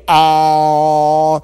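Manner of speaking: long, steady held "ah"; open-mouthed waiting. Acted. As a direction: hold an "ah" with mouth wide open. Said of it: "he was waiting like this".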